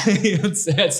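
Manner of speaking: normal talking voice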